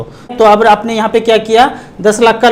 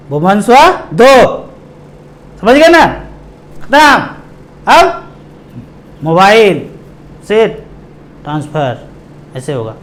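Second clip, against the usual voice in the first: word by word with long gaps; broken-up delivery; much slower than usual. Real, not acted. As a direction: dole out the hints in isolated pieces. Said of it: "तोड़ तोड़ के बोलूँगा". (टुकड़ों में, ठहर-ठहर कर)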